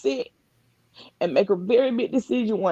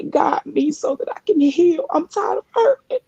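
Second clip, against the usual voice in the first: high, crying plea to God; tearful prayer; 8 semitones higher than usual. Real, not acted. Reliving her prayer to God, real sobbing plea. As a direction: voice cracking upward as she begs God to heal. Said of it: "I'm crying now".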